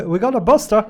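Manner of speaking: loud, high mock announcement; mock alarm call. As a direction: call it out to the whole team, hammed up. Mock official calling colleagues.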